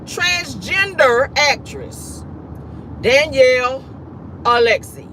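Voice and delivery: slow, spaced-out, pointed delivery; drawn out for comic emphasis; deliberate announcement. Real, not acted. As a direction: announce it slowly with big pauses. Spells out the news for effect.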